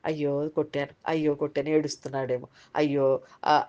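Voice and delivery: low, soft mock fretting; understated regret, dropped low and quiet; parent's heartache. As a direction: lightly act a parent's remorse, under your breath. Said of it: "మనసు పీకుతా ఉంటది కదా".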